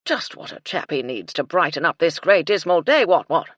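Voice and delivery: put-on loud, hearty old officer; blustering colonel. Colonel welcoming afternoon tea, loud blustering cheer. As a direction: boom it like a cheerful old soldier.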